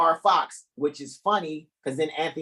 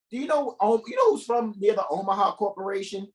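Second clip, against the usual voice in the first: usual speaking voice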